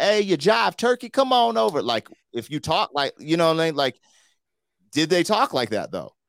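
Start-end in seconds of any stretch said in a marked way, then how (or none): 0.0-1.8 much higher hammed-up jive voice. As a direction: ham up the old cartoon jive talk. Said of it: "were they like".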